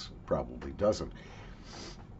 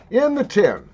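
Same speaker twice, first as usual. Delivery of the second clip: high sing-song; 9 semitones higher, 7 dB louder than usual. Acted. Tin catchphrase called out.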